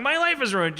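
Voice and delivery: high-pitched mock lament; mild high cry of woe, acted; imitated distressed friend. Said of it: "your best friends going".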